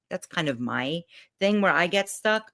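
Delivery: ordinary talk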